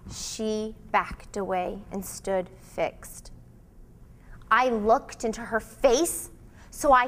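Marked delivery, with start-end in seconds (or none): none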